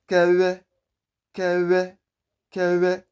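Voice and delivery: Kewe high and level, w squeezed through lips; correct vw pronunciation. Shown as a demonstration. Teacher drilling the name, correct vw pronunciation. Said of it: "squeeze air through your lips".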